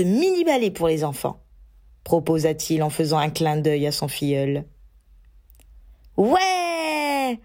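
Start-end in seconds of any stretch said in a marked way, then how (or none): 6.2-7.4 put-on high, happy child cheer; delighted yell. Teddy cheers at the broom race idea, high and delighted. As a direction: cheer like a thrilled boy. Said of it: "hurla Teddy ravi".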